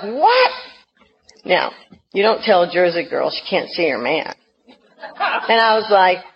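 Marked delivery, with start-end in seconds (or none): 0.0-0.5 high, sharp incredulous what; disbelieving yelp; squeaky shocked question. Retold or imitated. Told she can't see him.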